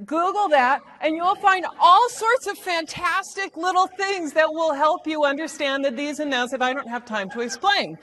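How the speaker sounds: normal talking voice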